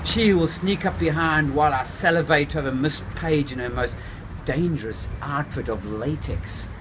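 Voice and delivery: plain reading voice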